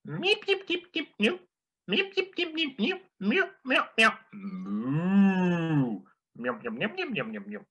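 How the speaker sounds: very high squeaky alien beeps. Retold or imitated. Telling the joke as an alien from outer space, squeaky meeps. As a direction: chirp it like a tiny space creature. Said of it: "as an alien from outer space".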